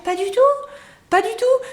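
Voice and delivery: high-pitched not-at-all; emphatic high denial. Real, not acted. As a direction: stamp out the myth. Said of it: "emphatic denial".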